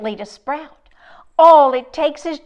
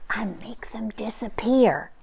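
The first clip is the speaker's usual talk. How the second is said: soft unvoiced whisper; 2 semitones lower, 6 dB quieter than usual. Jester whispering comfort into the sick girl's ear. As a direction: breathe it into her ear. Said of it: "the Jester whispered in her ear".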